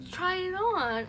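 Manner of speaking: high, bright coaxing, trying to sound upbeat; forced positive. Retold or imitated. Parents coaxing the upset daughter to try the dress, forced cheerful. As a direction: sell it like it's great news. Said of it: "in, like, a really positive voice".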